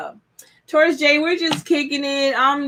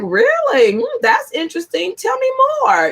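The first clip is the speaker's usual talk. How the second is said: intrigued interest; 11 semitones higher than usual. Retold or imitated. Mimics curious open new partner.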